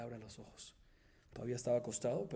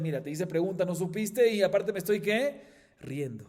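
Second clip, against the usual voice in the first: offended rebuke; 6 semitones higher, 11 dB louder than usual. Acted. Offended rabbi scolds student.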